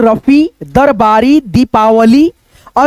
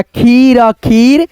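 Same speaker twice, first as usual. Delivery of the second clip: खीर खीर, drawn out slowly; slow syllable reading; much slower than usual. Shown as a demonstration. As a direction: read खीर खीर slowly, word by word. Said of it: "तो आप ऐसे पढ़ना". (धीरे धीरे पढ़ना)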